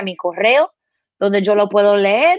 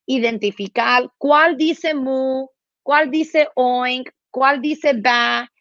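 slow teaching drill; 3 semitones higher, slower than usual. Shown as a demonstration.

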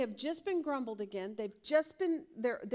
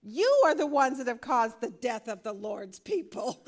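indignant accusation; 8 dB louder than usual. Retold or imitated. Grumblers blaming Moses and Aaron, loud indignant accusation. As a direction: hurl the blame at Moses and Aaron.

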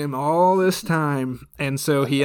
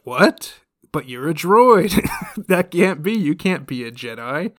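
shocked disbelief; 4 semitones higher, 6 dB louder than usual. Retold or imitated. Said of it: "and the Sith is like".

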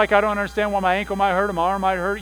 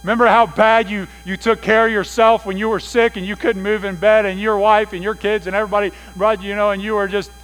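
raised, louder, teasing voice of God; 5 semitones higher, 9 dB louder than usual. Pretend. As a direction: playfully needle the men as God. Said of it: "God's like".

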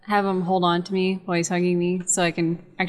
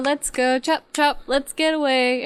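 higher-pitched, urging call; urgent hurrying; 7 semitones higher than usual. Acted. Fairy hurrying the party away from the creature, urgent high urging. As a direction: hurry everyone along, voice raised in pitch.

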